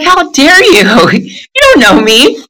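high, slow offended protest; mock outrage. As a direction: play it hurt and indignant. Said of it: "I was so pissed off".

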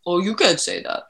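quiet, low scolding inner voice; a hint of a nagging critic; mock ego voice. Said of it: "our ego says".

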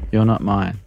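ordinary delivery, nothing marked

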